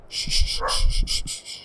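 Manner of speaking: unvoiced hushing of the dog; whispered hush. Real, not acted. Hushing the dog during the recording, whispered. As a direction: whisper shh to calm the dog.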